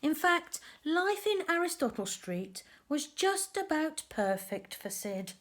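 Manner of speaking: lively storybook narration for little ones; reading to children. A narrator's reading. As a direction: tell the tale to a group of kids. Reads picture book to children.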